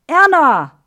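loud, high cry calling out Erna; shouting a name. Acted. Frank cries out to Erna as she leaves, shouting. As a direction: scream her name in despair. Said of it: "schreit Frank".

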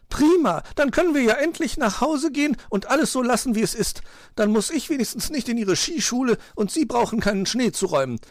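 high, loud gleeful magpie; delighted. A narrator's reading. Magpie glad to go home, delighted. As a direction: rejoice in a high, excited voice. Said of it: "freute sich Frau Elster".

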